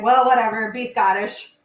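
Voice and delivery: loud, careless shrug of a line; mock dismissive. Mocking the BBC's shrug about accents, loud and dismissive. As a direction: toss it off like it doesn't matter. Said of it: "and they're like".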